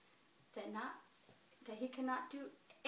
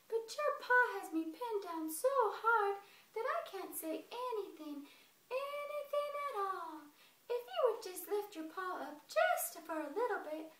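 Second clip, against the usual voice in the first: higher, meek sweet-talking squirrel; pleading squirrel; 7 semitones higher, 4 dB louder than usual. A narrator's reading. Squirrel pleading with the bear, higher and meek.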